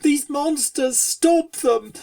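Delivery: frightened colt begging the town for help; terrified scream. A narrator's reading. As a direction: cry out to the crowd in fear. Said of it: "terrified, screamed for".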